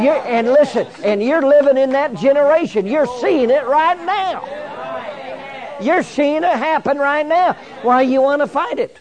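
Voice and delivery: high, loud preacher's urging; impassioned preaching. Real, not acted. Preacher telling the church they are living in that generation, impassioned preaching.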